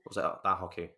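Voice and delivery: ordinary delivery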